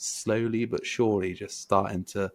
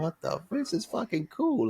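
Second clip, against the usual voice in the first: high-pitched retold amazement; amazed reaction; 12 semitones higher than usual. Recalling first seeing the Instagram, mock amazement. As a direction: lightly re-enact being pleasantly stunned, pitch jumping up.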